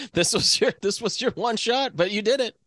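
high, taunting website voice; mocking website voice. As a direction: tease like a smug website. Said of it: "And it was like".

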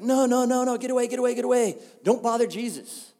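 high-pitched, repetitive shooing; acted fussy dismissal, pitched well up; urgent shooing. Disciples keeping kids away from Jesus, urgent shooing.

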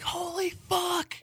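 high, stretched-out astonishment; amazed. Retold or imitated. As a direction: squeal it out slowly in shock. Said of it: "I was like".

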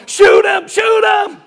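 high, loud acted movie-fan yell; excited urging shout. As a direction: egg the hero on, shouting high. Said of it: "you're like going".

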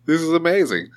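high-pitched, amazed disbelief; acted astonishment. Fan discovering hidden albums nobody told him about, acted astonishment. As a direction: play up the thrilled shock of discovery.

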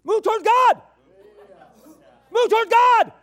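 high, loud exhortation; full-force preaching cry; preaching shout. Real, not acted. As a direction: call them toward God at full voice.

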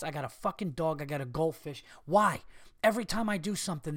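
normal talking voice

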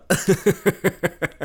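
loud, high, mocking laugh; gloating laugh at the injured woman; cruel laugh. Villain mocks injured woman.

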